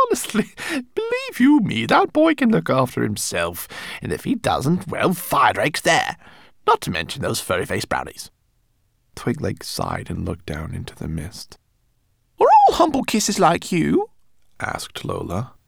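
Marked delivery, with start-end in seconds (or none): 0.1-8.3 brisk rat reassuring. A narrator's reading. 12.4-14.1 high, loud rodent voice; exasperated rat. A narrator's reading. Rat needling her worrying companion, high and exasperated.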